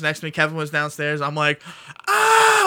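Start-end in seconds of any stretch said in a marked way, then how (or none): 2.1-2.7 high, loud excited scream; retold yell. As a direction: re-enact yelling alone at the TV. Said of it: "in the house yelling".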